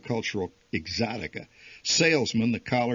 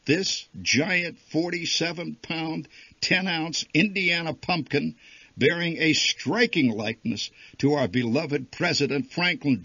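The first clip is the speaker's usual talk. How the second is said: grand sign reading; 7 semitones higher, 4 dB louder than usual. A narrator's reading. Narrator reading the fair sign as a grand proclamation. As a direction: read it like a pompous placard.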